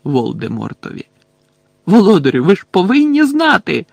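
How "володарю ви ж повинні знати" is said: loud, high-pitched imploring; tearful plea. A narrator's reading. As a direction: beg the master desperately. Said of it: "ридаючи". (відчайдушне гучне благання)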